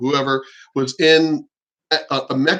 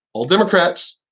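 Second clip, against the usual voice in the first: normal talking voice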